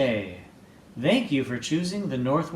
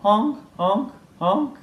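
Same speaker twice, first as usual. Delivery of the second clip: higher, louder goose honking; goose honks; 7 semitones higher, 4 dB louder than usual. Acted. Geese trying to calm things down, honking. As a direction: honk like a goose smoothing things over. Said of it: "The geese stepped in".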